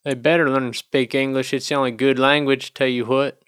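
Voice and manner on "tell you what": ordinary talk, nothing marked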